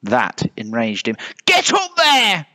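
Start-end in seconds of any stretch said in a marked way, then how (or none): 1.4-2.5 high, drawn-out command; little bear's bossy shout; barked order. A narrator's reading. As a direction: order them up, yelling high. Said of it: "he ordered".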